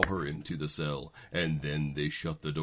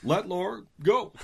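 Obama impression; 13 semitones higher, 8 dB louder than usual. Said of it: "Barack Obama was out there".

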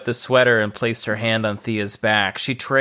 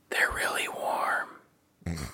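whisper; 6 semitones lower, 11 dB quieter, much slower than usual. Acted. Selena coaxing girlfriend softly.

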